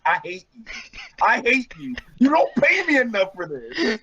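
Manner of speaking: high-pitched, tearful mock rant; mock tantrum. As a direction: build into a hammed-up whiny meltdown. Acted tantrum in author's notes.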